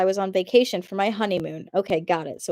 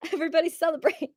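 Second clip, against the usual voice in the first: laughing; 8 semitones higher, 5 dB quieter, faster than usual. Real, not acted. Laughing at own celebration.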